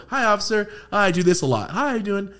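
high, sweet and overly polite; butter up the officer with a bright hello; polite greeting to a cop. Retold or imitated. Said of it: "That's why I just go".

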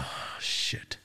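hushed unvoiced whisper of dread; whispered oh shit. To himself, dreading court, in a whisper. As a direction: breathe it out under your breath. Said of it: "I'm like".

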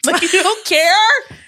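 high, drawn-out mock shout; mock outburst. Co-host acting out a date with the ex, mock shout. Acted outburst in imagined dinner exchange.

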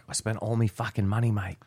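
light Aussie impression of a broke bloke; a slightly rueful Australian on "me fucking money"; Australian accent. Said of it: "So he's clearly Australian".

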